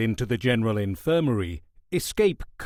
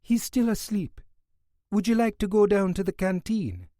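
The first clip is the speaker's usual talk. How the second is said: West Indian nurse; 8 semitones higher than usual. Acted. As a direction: gently coax the guard away.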